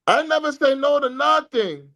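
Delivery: high-pitched mock boxer voice, each word spaced out; Canelo Alvarez impression. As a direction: put on a high voice for a quoted fighter. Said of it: "Canelo Alvarez said".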